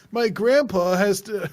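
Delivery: high, loud, slow stilted read; bad voice acting. Imitating the hero's stilted voice acting, high and slow. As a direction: ham it up like a clumsy dub. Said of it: "he delivers it like all his lines".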